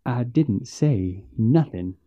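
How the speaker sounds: soft, slow, frightened reply; fearful. A narrator's reading. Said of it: "more than a hinge of fear in his voice".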